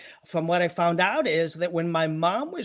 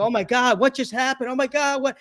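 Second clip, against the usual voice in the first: higher, lightly voiced alarm; retold panic; 7 semitones higher than usual. Chiropractor fearing he hurt his patient, mild mock panic. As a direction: stammer out shocked dismay. Doctor panics after crunch.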